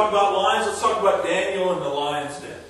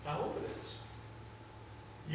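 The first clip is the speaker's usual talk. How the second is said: whispered hope; 5 semitones lower, 21 dB quieter, much slower than usual. Real, not acted. Whispered aside to congregation.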